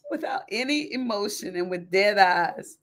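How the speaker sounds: usual speaking voice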